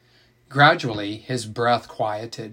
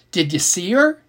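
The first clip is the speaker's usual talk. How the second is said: eager and higher, a little louder; excited; 6 semitones higher, 4 dB louder than usual. Acted. Little sister eagerly quizzing Jess about the funeral, excited. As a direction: blurt it out with eager curiosity. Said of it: "he asked excitedly".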